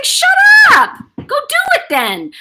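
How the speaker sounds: high, loud mock yell; imitated grandmother's brusque holler; scolding grandmother. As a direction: snap it like a mouthy grandma. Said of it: "she was just saying".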